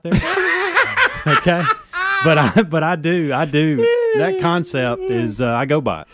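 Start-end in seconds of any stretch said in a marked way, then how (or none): 0.1-3.8 high laugh running into the words; laughing at own confession. Real, not acted. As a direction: talk through the laughter.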